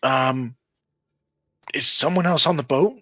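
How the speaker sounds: put-on very high, small pleading child voice; little girl begging to be let out of a sack; younger little girl. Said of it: "she sounds younger".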